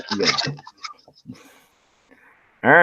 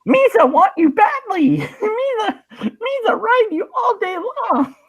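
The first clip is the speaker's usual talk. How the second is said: high, squeaky Gungan voice, loud; cartoonish alien creature voice; Jar Jar Binks voice; 4 dB louder than usual. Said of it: "It's Jar Jar Binks' sex robot voice".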